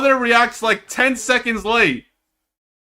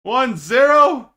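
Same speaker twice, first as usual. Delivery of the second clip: incredulous; 5 semitones higher, 4 dB louder, slower than usual. Real, not acted. Disbelief at the score.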